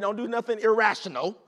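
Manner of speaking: ordinary talk, nothing marked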